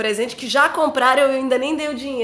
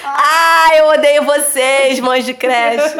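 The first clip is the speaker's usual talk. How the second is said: loud, slow playful outburst; mock rant; 8 dB louder, much slower than usual. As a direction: shout it in jest. Mock rant at creche moms.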